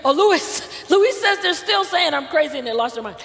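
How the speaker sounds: ordinary talk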